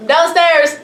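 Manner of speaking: called out loud to another room; yelling across the room. Real, not acted. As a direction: shout it to someone downstairs. Answering someone in another room.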